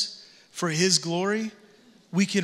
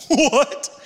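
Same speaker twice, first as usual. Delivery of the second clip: laughing; 6 semitones higher, 4 dB louder than usual. Real, not acted. Laughs at own absurd example.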